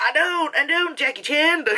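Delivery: high, hammed-up Irish accent; Pierce Brosnan's Irish accent. Official denying to a grieving father, mock Irish accent. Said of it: "That's Pierce Brosnan's accent".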